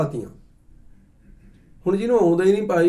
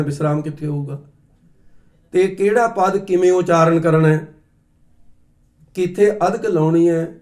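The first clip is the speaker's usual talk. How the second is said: usual speaking voice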